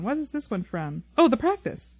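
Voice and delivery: ordinary talk, nothing marked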